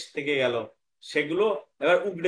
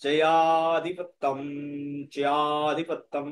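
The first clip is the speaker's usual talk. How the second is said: জয়াধিপত্যম with held long vowels; measured Sanskrit chant; slow pronunciation model; much slower than usual. Shown as a demonstration. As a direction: stretch the word evenly for pupils to repeat. Teacher models word for pupils.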